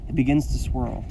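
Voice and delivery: plain reading voice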